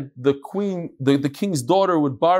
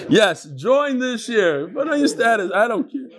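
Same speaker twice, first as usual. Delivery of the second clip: higher, laughing lightly; 5 semitones higher than usual. Real, not acted. Teacher teasing students about posting status, laughing. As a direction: say it through a chuckle. Joking to the class.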